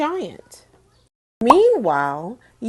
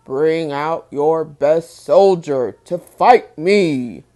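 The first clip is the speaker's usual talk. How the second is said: a hint of a booming giant, louder; 4 semitones lower, 6 dB louder, slower than usual. Giant Goliath challenging King Saul's army, lightly booming. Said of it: "he boomed".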